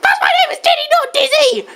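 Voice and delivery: very high cartoon indignation; high-pitched mock outrage; angry Diddy Kong. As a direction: correct them in a squeaky-high huff. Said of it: "Okay, calm down".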